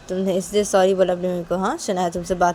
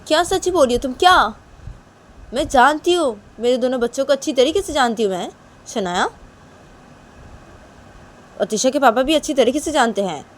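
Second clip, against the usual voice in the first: higher, louder, insistent motherly voice; indignant mother; 6 semitones higher, 8 dB louder than usual. Acted. Mother tells Shanaya she knows her children, indignant. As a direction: argue back as a hurt mother.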